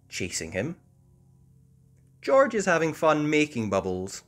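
plain reading voice

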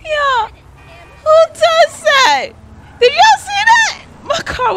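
very high, loud squeal of disbelief; outraged disbelief. Real, not acted. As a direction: squeal it out high, slow and incredulous. Outraged at own crash in game.

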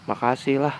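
ordinary talk

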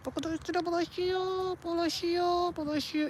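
whiny pet talk. Real, not acted. (yüksek perdeden, yumuşak bebek sesiyle)

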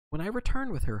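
usual speaking voice

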